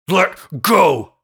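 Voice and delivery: loud, high roar at her. A narrator's reading. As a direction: bellow it, fighting. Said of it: "he roared at Pearl".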